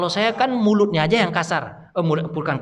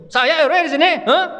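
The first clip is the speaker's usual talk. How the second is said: boastful official; 10 semitones higher, 4 dB louder than usual. Pretend. Imagined local official boasting.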